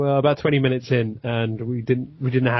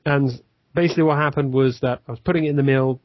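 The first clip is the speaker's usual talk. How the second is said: usual speaking voice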